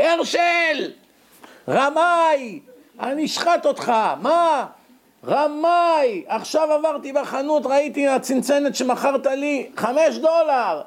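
high-pitched angry yelling at the cheat; furious accusation. Retold or imitated. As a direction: rage at being swindled.

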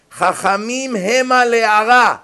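solemn chanted scripture; verse intoned. Acted. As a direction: lay out each word of the verse. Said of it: "שימו לב איזה פסוק".

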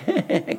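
brief imitated dopey chuckle; mock idiotic laugh. Preacher mimicking a man laughing at his judgment, mock idiotic laugh. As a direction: laugh like a fool, put on. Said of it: "הוא מתחיל לצחוק".

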